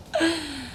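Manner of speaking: playful laugh. Real, not acted. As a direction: giggle at your own tease. Laughs at own hometown tease.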